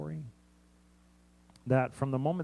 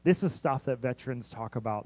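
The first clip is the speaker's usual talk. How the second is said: ordinary talk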